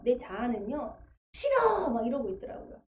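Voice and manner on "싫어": high, sharp cry of no; defiant refusal. Retold or imitated. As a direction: refuse like a stubborn child. Her stubborn self refusing.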